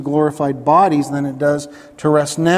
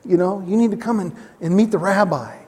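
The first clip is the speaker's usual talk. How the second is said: brisk, higher, eager pitch to hear about heaven; eager evangelist; 5 semitones higher, faster than usual. Retold or imitated. Preacher voicing a keen evangelist inviting people to heaven, eager. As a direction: sell heaven like a keen street preacher, quickly. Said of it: "You know, what an evangelist".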